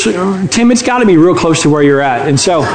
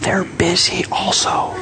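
put-on soft, slow kid's whisper; small whisper; 8 dB quieter, slower than usual. Child putting off the telemarketer, whispering. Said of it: "whispered Jimmy".

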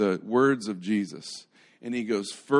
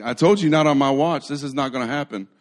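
loud acted protest, higher at first; mock defiant outburst; 4 semitones higher, 7 dB louder than usual. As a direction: blurt it out as an indignant Peter. Acting Peter lashing out with sword.

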